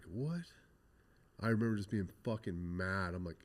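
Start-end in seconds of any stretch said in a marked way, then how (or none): 0.0-0.5 sharp, higher disbelieving what; stunned what. Retold or imitated. Gang laughs at his plan, he asks in shock. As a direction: caught off guard, can't believe it.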